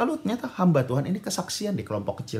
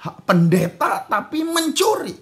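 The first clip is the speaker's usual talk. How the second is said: high, drawn-out angry accusation; furious father; 10 semitones higher, 5 dB louder, much slower than usual. Retold or imitated. Father accusing his son of stealing, furious. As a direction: stretch out the scolding, building louder. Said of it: "Papanya marah besar karena papanya bilang".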